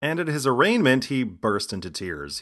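ordinary delivery

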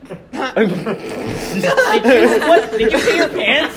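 high loud laughing shrieks; laughing disgust. Real, not acted. Friends react to wet pants.

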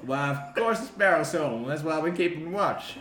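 folksy guard. Acted. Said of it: "kinda like".

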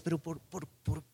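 soft, stammering regret under the breath; muttered self-reproach. Retold or imitated. A man at home, regretting his words, muttering softly. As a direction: mumble it, kicking yourself for what you said. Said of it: "luego en casa dices".

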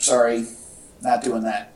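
usual speaking voice

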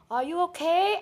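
lightly voiced lady, high-pitched question; imitated concerned lady. Lady at the restaurant checking on her, lightly imitated. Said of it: "the lady was like".

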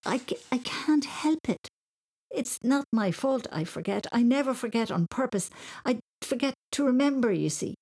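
high, stammered start, earnest protest; pleading explanation. A narrator's reading. Boy excuses forgetfulness to his mam, high pleading. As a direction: plead innocence, pitch lifted. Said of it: "he tried to explain".

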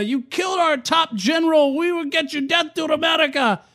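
high-pitched imitation of mad protesters; mock angry mob. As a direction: mimic an outraged crowd chanting. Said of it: "they're mad at America".

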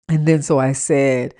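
ordinary talk

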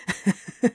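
breathy helpless giggle; helpless laughter. Acted. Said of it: "laughed harder".

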